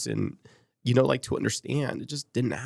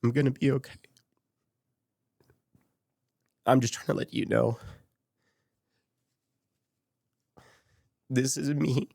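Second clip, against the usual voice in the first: choked up; 3 semitones higher than usual. Retold or imitated. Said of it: "I'm getting more choked up now".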